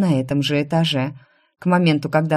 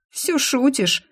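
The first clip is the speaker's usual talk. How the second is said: sobbing question; 9 semitones higher, slower than usual. A narrator's reading. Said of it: "всхлипнула она".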